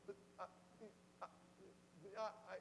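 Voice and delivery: halting, quiet false starts; itching to interrupt. Acted. Listener with an answer already formed, stammering to interrupt. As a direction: stammer as if waiting to cut in. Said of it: "they go, they go".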